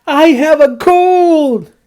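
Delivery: happy announcement. Acted. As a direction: tell it like a prize won. Gerald tells Piggie happily.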